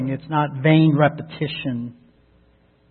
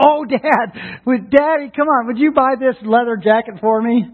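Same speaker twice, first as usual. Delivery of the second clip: put-on pleading teenager; 5 semitones higher than usual. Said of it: "some teenager runs up to you and says".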